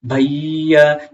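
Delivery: भैया held very slowly; drawn-out call. Shown as a demonstration. Teacher demonstrating a long call, भैया stretched slowly. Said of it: "सांस जब तक चल जाती है".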